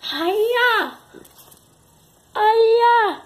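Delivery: very high, loud delighted squeal; excited squeal. Real, not acted. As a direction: squeal with glee. Child squealing at the dog. (gritinho animado)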